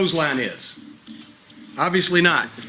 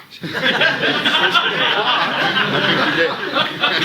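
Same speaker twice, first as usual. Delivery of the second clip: long hearty laugh; 6 semitones higher than usual. Real, not acted. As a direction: crack up laughing hard. Coach cracks up at joke.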